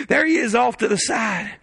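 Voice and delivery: ordinary talk, nothing marked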